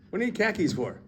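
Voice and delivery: "car keys" said like "khakis", high-pitched; puzzled high voice, "car keys" turned into "khakis"; confused mishearing. Acted. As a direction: ask baffled, "car keys" coming out as "khakis". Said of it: "you said khakis".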